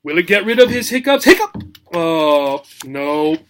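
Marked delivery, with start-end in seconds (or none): 1.3-1.6 very high sudden hiccup. Pretend. Acts hippo hiccup.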